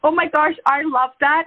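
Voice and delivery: usual speaking voice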